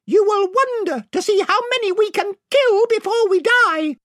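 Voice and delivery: very high little warrior voice; Reepicheep. A narrator's reading. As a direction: pipe up in stern defence of the queen. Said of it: "added Reepicheep".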